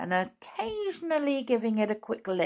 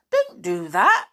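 slow, louder complaint; louder protest; 8 dB louder than usual. Acted. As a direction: protest sharply. Binkle hurt by a tap.

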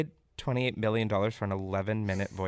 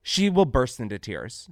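ordinary talk, nothing marked

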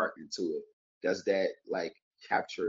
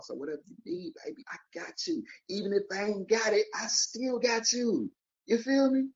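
pitched way up, playful promise; high put-on voice. Promising the audience help in a high put-on voice. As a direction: croon it high to the listener. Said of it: "I can do a whole bunch of voices".

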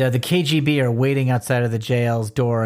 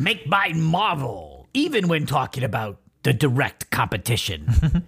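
slow, hammed-up showman impression; Stan Lee; slower than usual. Host jokes about plugging the rival publisher, hammed-up impression. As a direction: stretch it out like a comic-book huckster. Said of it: "we talk about Stan Lee every episode".

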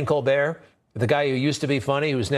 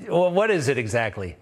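normal talking voice

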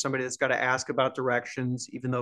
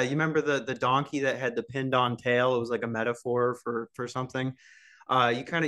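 ordinary talk

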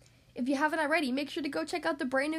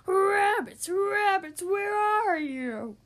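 howled call; 5 semitones higher, 7 dB louder, much slower than usual. Acted. Wolf Boy calling for rabbits.

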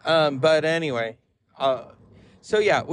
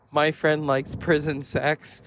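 ordinary talk, nothing marked

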